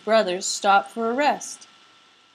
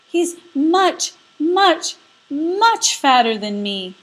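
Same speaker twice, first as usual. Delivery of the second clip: high, loud stretched goat voice; pleading goat; 6 semitones higher, 5 dB louder, slower than usual. Acted. Goat pleads, making himself small.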